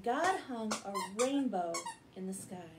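plain reading voice

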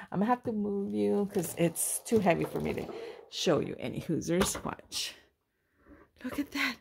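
ordinary talk, nothing marked

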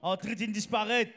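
high, loud, slow shout; triumphant proclamation. Real, not acted. Interpreter relaying a healing to congregation, triumphant. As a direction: echo the preacher at full voice.

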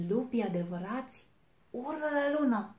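loud, high wolf cry; wolf shouting. A narrator's reading. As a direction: bellow it at the little wolf. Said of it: "a strigat lupul cel mare și rău".

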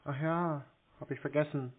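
ordinary talk

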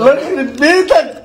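high laughing outburst. Real, not acted. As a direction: crack up at your friend. Bursts out laughing at friend.

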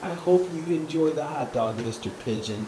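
soft, low and dejected; sad duck. Acted. Duck wishing the pigeon well, sad. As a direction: let it sink quietly, a mildly sad duck. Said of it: "the duck is getting sad".

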